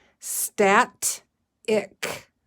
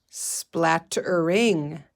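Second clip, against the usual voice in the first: Splattering stretched out very slowly, syllable by syllable; stretchy snaking; 2 semitones lower, much slower than usual. Shown as a demonstration. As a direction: stretch Splattering out like a snake for kids. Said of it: "stretchy snaking".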